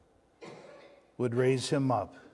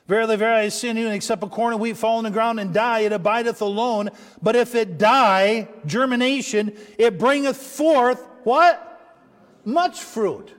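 loud, ringing preacher's call, building to the answer; full-force preaching; 5 semitones higher, 7 dB louder than usual. Acted. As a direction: drive the verse home to the church.

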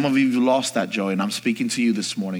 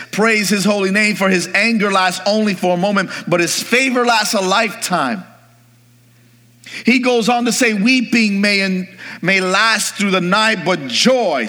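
high, ringing scripture proclamation; preacher lifting his voice over the verse; raised-voice preaching; 8 semitones higher than usual. Real, not acted.

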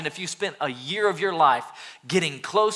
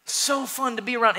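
excited; 7 semitones higher, 4 dB louder than usual. Real, not acted. Excited about Jesus.

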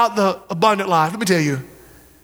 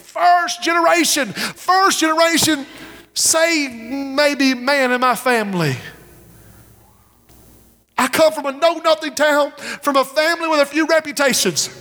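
raised, higher preaching cry; preaching at full force; 6 semitones higher than usual. Acted. Preacher calling out young men, raised and fervent. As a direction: lift it up and call the young men out. Preacher exhorting congregation loudly.

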